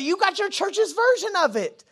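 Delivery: ordinary delivery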